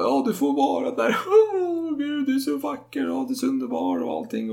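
very high sobbing falsetto gushing; hammed-up weepy mother praising her son; high pitched mock crying; mock tearful mother. Mother moved to tears.

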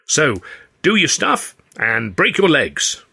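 high jaunty farewell with a slow finish; cheery send-off. A narrator's reading. Claude leaving the dressing room, jaunty. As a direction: Claude wishing the act luck, jaunty.

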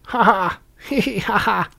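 high-pitched, hammed-up witch's cackle; wicked fairy cackle. A narrator's reading. Wicked fairy after cursing the baby, high cackle. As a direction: cackle in triumph like a crone. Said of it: "I hadn't done laughing".

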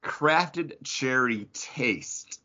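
slow, drawn-out ad read; mocking the label. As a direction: savor each word like a snooty label. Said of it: "A lot of adjectives in there".

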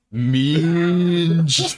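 stretched-out comic blurt; Jake. Retold or imitated. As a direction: impersonate a friend savouring a silly word. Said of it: "He's like".

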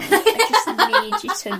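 a mock plea broken by laughter; mock email, laughing. Emailing the restaurant, laughing mock plea. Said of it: "email Bella Italia and be like".

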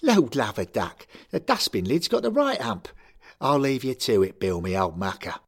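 put-on loud chirpy Cockney accent; breezy Cockney send-off. A narrator's reading. Larry leaving the room, breezy Cockney accent. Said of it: "Larry McGettigan rolled his eyes".

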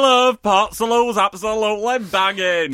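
loud, high delighted praise; excited cheer. Real, not acted. Co-host hyping Port Salut to listeners, loud delighted cheer. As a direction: cheer for the cheese.

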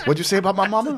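high, mock street-fight challenge; a hint of a hammed-up tough guy. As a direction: play it like picking a fight. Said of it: "you come in like".

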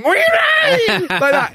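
high squealing imitation of a crowd; mock crowd squeal. Imitating a squealing stadium crowd, high and gleeful. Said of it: "the crowd actually squeal".

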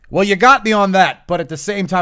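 loud, high mock indignation; imitated indignant concession. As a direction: burst out as the exasperated opponent. Retold argument, opponent concedes grudgingly.

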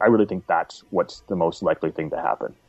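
ordinary talk

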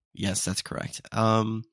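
ordinary talk, nothing marked

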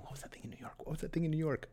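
hushed, breath-only muttering to oneself; urgent whispered self-questioning; whispered inner voice. Acted. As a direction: whisper it like a brain searching its memory. Voicing his own brain searching memory.

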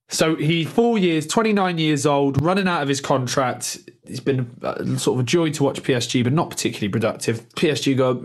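ordinary delivery

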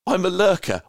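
high, loud mock confession; hammed-up fan voice; playful quote. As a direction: announce it proudly like a shy fan owning up. Quoting the fan's confession.

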